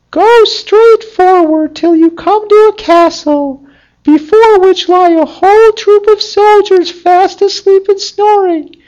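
very high, squeaky little fox voice; talking fox. Acted. Fox warning the gardener's son, in a high squeaky voice. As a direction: pipe it up high like a tiny clever fox.

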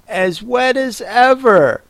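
high-pitched melancholy lament. A narrator's reading. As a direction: complain mournfully in a high voice. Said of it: "said Alice in a melancholy tone".

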